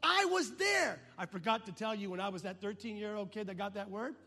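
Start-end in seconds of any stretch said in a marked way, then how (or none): 0.0-1.0 insistent. Retold or imitated. Wife's high retort.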